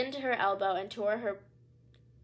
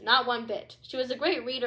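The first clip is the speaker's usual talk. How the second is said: ordinary delivery, nothing marked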